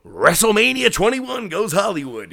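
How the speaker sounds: tagline shout. Retold or imitated. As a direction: belt it out like a show tagline. Quoting the big tagline.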